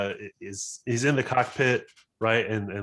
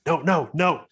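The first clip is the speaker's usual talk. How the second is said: higher, urgent string of refusals; frantic protest; 7 semitones higher, 4 dB louder than usual. Acted. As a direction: protest as the panels fail. Pilot pounds on failing panels.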